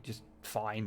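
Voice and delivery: ordinary delivery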